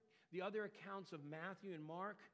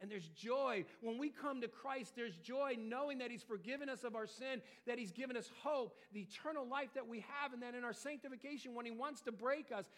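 raised, louder preaching voice with strong emphasis; fervent preaching; 5 semitones higher, 5 dB louder than usual. Acted. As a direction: preach it out to the congregation. Preaching on joy in Christ.

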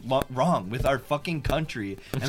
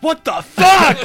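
laughing outrage; 14 dB louder, slower than usual. Real, not acted.

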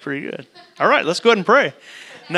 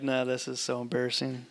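put-on much softer, lower, a mumbled teen groan; embarrassed teenager; 4 semitones lower, 11 dB quieter than usual. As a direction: lightly do a teenager who is cringing. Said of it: "you got a teenager".

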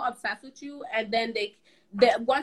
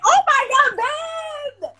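loud, high, excited yelp; excited shout; 13 semitones higher, 10 dB louder than usual. Real, not acted. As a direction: shout it out in delight. Drunk baby voice requested.

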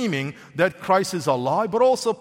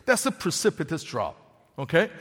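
ordinary talk

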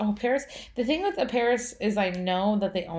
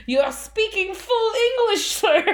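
mock outrage; 12 semitones higher, 5 dB louder than usual. Mock indignant guest reply.

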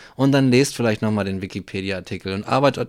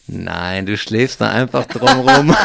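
laughing; 2 semitones lower, 6 dB louder, slower than usual. Retold or imitated. Retold scene, laughing.

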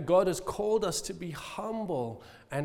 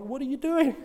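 baffled question; 9 semitones higher than usual. Retold or imitated. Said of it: "I'm like".

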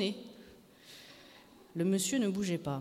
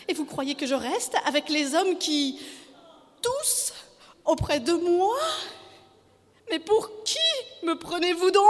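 high, outraged wife; theatrical high-pitched outrage; fresh anger; 8 semitones higher, 4 dB louder than usual. A narrator's reading. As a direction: demand it in high, affronted bursts. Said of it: "un sursaut de colère nouvelle".

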